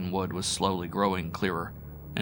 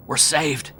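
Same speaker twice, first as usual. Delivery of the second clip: higher, jubilant shout; 7 semitones higher, 4 dB louder than usual. A narrator's reading. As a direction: cry out in relief. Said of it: "he shouted".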